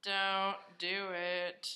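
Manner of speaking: very slow, drawn-out plea; mock warning. Co-host warning against Craigslist meetups, slow drawn-out mock plea. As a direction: stretch every word right out.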